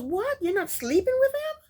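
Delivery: high, shocked disbelief; shocked mother. Retold or imitated. Mother hearing her daughter sleeps apart, shocked. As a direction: gasp it out like a scandalised mother. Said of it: "And the mother is shocked".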